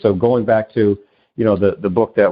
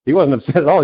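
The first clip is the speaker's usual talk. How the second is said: usual speaking voice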